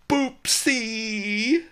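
high, loud delighted squeal of a pet name; happy squeal. A narrator's reading. Lotus Cloud greets the ox at her window, squealing happily. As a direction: squeal it like a girl thrilled to see her suitor. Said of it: "Lotus Cloud squealed happily".

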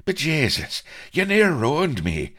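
high, loud, pained protest; hurt, squeaky grievance; pained complaint. A narrator's reading. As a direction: yelp it as if just hurt. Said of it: "grunted painfully".

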